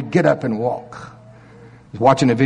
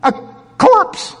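high, incredulous retelling; pitched-up, stretched key words; dramatic storytelling; 4 dB louder, slower than usual. Acted. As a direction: act out an outrageous feat. Acting out corpse stood up.

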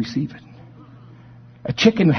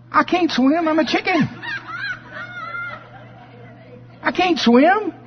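much higher, anxious little voice; timid duckling. Acted. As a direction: squeak out the excuse like a scared chick. Chicken-raised duck refuses to swim.

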